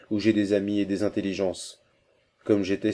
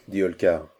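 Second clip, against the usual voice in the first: ordinary delivery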